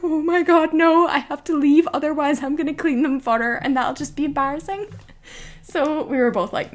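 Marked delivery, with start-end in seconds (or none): 0.0-4.9 imitating an appalled friend, high voice; retold horrified outburst, pitched up.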